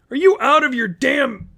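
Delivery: very high, cut-off shout; a high indignant yell cut short; outraged yell. Acted. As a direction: yell at your friend, then stop. Bill cut off mid-yell.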